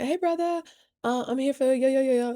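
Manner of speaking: put-on high, sweet little-girl voice; girlish high greeting to the owner's son; sugary greeting. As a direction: charm him with a high sweet hello.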